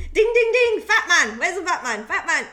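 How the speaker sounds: high, loud announcement chime and call; mock tannoy call. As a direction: ring it out like a factory tannoy. Imitating tannoy calling fat man.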